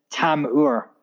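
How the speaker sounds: "Tam-ur" with a clear open "tam" first; Scottish pronunciation. Shown as a demonstration. Guest showing how Scotland says his birth name, Scottish pronunciation of "Tam-ur". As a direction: say "Tam-ur" in two full syllables, "tam-oor". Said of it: "we pronounce it".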